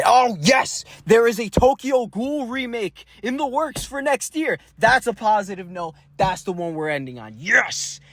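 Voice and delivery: giddy high-pitched fan joy; excited. Real, not acted. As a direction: squeal it like a thrilled anime fan. Excited about Tokyo Ghoul remake.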